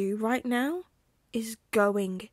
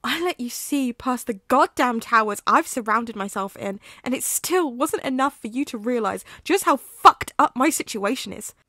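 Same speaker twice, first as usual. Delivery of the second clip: rising from bitter to all-out shouting; furious scream at a friend; furious outburst; 4 semitones higher, 10 dB louder than usual. Acted. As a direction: hurl it at him, building to a yell. Remus rages at Sirius.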